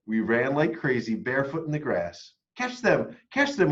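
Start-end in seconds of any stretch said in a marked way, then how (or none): 2.6-3.7 higher, excited shouts; excited cry. A narrator's reading. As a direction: cry it out, chasing the lights. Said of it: "we cried".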